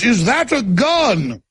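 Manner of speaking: mock alarm. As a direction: imitate someone aghast. Alarmed friend on seeing gun.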